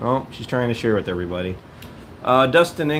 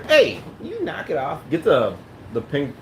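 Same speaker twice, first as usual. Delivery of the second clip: parental scolding; 6 semitones higher than usual. Real, not acted. Dad to daughter who chews the furniture, parental scolding.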